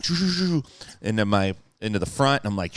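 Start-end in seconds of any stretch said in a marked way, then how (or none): 0.0-0.6 repeated rushing shoo sounds; imitated dashing whoosh. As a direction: make the sound of a quick dash. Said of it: "And I ran out shoo".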